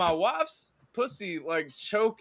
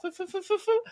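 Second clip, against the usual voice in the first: doctor's snipping noise; 13 semitones higher, faster than usual. Retold or imitated. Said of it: "and she goes".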